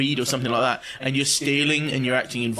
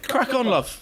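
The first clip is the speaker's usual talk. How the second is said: cheery encouragement; 10 semitones higher, slower than usual. Acted. Cheering a woman on.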